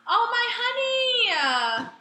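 breathy gasp, then a high, slow, crying "Oh, my honey"; mock swooning gasp. Friend mocks her swooning over boyfriend Chase, high and slow. Mocking girl gasping at Chase.

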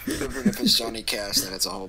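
laughing high through the words. Real, not acted. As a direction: talk while cracking up. Laughs while mocking the song.

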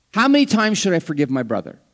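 preacher voicing Peter's eager question; Peter asking. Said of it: "Peter has never shied away from asking".

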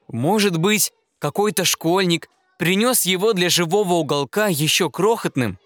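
ordinary delivery, nothing marked

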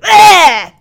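loud high mock shout; mocking yell. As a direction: throw his yell back at him. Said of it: "this is how ridiculous you sound".

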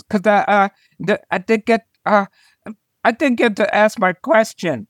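a hint of a shy little boy explaining. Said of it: "And he goes".

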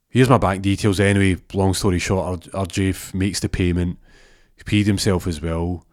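ordinary talk, nothing marked

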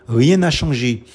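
ordinary talk, nothing marked

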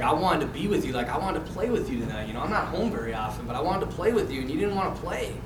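soft, subdued retold heart-to-heart; tender dad. Dad telling son he wanted time together, soft imitation. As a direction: imitate a father gently opening up.

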